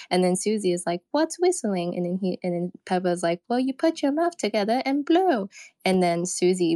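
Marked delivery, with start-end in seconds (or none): 1.1-1.9 higher, puzzled cartoon child; high Susie voice. Susie's reply in retold scene. 3.5-5.5 high cartoon child voice. Susie explains, retold scene.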